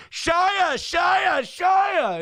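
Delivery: high, loud shouts of a name; mock the pushy fans shouting; hammed-up fan yells at a celebrity; imitating fans yelling. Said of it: "They're just yelling".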